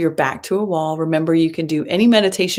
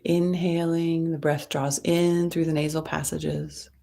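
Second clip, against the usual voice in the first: guided meditation. Acted. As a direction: ease them into the breath, gently and unhurried.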